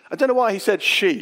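usual speaking voice

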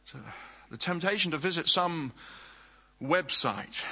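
ordinary talk

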